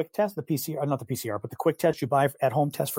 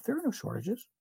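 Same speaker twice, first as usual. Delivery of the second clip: Biden impression; 6 semitones higher, 7 dB quieter than usual. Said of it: "Did you see his messaging on this?".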